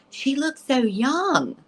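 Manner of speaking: high, slow, marvelling; drawn-out, high-pitched amazement; excited wonder. Retold or imitated. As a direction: imitate someone astonished by a vision. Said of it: "is so excited".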